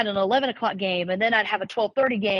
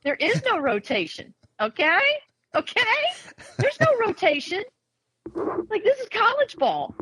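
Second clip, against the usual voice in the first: higher, mock-scolding coach voice; exasperated coach; 6 semitones higher than usual. Coach telling a freshman pitcher there's no rotation, high mock scolding. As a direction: set the girl straight, hammed up.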